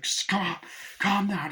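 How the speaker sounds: a frantic man trying to calm a friend; mock pleading. Retold film character urges a crazed man to calm down, high pleading.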